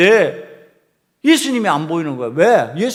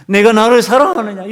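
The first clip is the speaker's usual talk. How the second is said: loud third asking; 5 semitones higher, 6 dB louder than usual. Acted. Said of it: "세 번 질문을 합니다".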